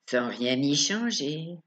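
usual speaking voice

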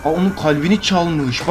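ordinary talk

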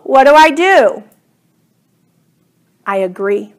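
ordinary delivery

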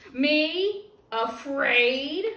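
loud, high indignant bellow. Acted. T-Rex bellows at the kid who called him afraid. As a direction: roar the words back at him. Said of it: "he bellows".

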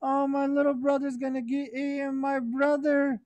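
high, teary wail for his stolen brother; crying little brother. Acted. Little brother crying to himself that his twin was stolen, high and teary. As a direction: plead like a small boy crying for his brother. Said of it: "He's saying".

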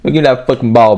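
ordinary delivery

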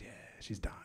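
very soft, voiceless mock vet; acted low-key grim verdict; hushed bad news. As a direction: drop to a near whisper for the diagnosis.

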